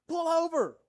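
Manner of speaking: put-on sudden high child's yell of pull over. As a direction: yell it like a kid in the back seat. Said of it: "I just hear Darden go".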